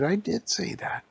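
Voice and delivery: high-pitched indignant character voice; indignant protest. Acted. Acted bickering between story characters.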